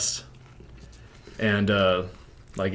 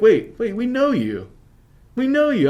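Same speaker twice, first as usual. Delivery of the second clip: very high, loud cop voice; dawning recognition; 7 dB louder than usual. Retold or imitated. Cops to the guy they pulled over, excited recognition. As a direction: imitate cops suddenly recognising someone.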